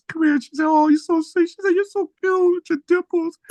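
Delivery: high, sweet imitation of a woman cooing. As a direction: coo it like she's charmed by a shy kid.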